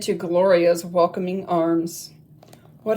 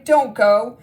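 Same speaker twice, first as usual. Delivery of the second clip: calling out; 4 semitones higher, 8 dB louder than usual. A narrator's reading. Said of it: "she cried".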